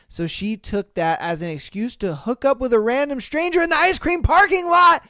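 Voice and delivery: normal talking voice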